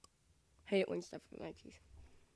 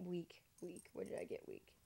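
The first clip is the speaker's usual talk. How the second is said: whispering; 3 semitones lower, 15 dB quieter than usual. Real, not acted. Muttered self-correction aside.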